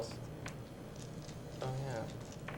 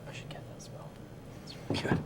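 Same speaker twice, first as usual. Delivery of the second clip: whisper; 15 dB quieter than usual. Real, not acted. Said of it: "whispered aside at table".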